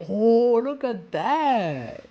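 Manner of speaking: slow high amazed wow; drawn out in awe at the page; hyped, stretched-out look at that; excited wow. Real, not acted. Sees a manga panel.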